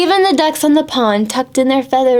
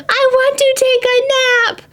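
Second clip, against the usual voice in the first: mock shout; 14 semitones higher than usual. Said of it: "shouted Annalise Devon McFleece".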